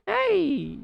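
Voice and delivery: high laughing "hey"; playful squeal. Real, not acted. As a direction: burst into a high-pitched giggly "hey". Clowning about sweating mid-lesson.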